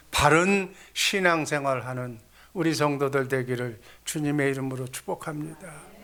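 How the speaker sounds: usual speaking voice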